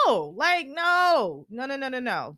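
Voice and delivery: loud, high refusal; firm repeated no; emphatic no. Real, not acted. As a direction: shut the idea down hard. Rejecting the habit emphatically.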